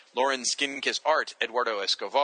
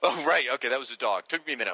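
ordinary delivery

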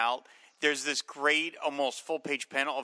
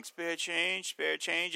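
put-on slurred, softer drunk pleading for change; drunk beggar voice; 4 semitones higher, 4 dB quieter, much slower than usual. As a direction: mumble it like a drunk bum begging. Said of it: "in disguise as a drunk".

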